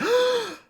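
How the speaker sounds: sharp, high imitated gasp; crowd gasp. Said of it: "the crowd immediately, like".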